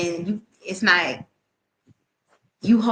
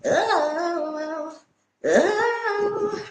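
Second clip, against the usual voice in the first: high, long fake sob; hammed-up moan of grief; mock wailing; 9 semitones higher than usual. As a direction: pretend bawling "oh". Pretend wailing.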